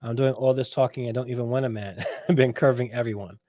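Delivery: ordinary talk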